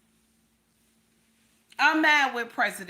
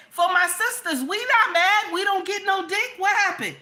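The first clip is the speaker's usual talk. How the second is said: high indignant shout; 7 semitones higher, 4 dB louder than usual. Real, not acted. Rant for her sisters.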